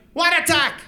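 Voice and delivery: high, loud puzzled bark; clueless old guy. Retold or imitated. Old man baffled by the attack, loud and high. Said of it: "And he goes".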